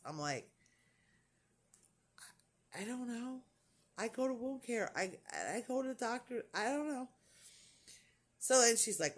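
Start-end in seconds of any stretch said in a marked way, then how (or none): 2.8-7.1 retold helpless answer. Acts out her helpless answer.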